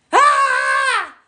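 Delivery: high, loud cry of exasperation; frustrated scream. Real, not acted. Vents frustration instead of words.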